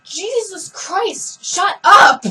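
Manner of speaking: slow drawn-out groan then a loud snapped shout; fed up and yelling at the singer to stop; exasperated shout. Retold or imitated. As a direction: lose patience and yell it out. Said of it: "I'm like".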